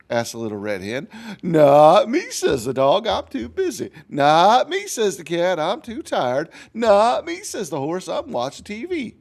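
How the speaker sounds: put-on lively storybook narration for kids; reading to children. A narrator's reading. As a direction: read it like a picture book to a class. Teacher reads story to children.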